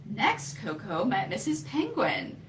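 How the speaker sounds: plain reading voice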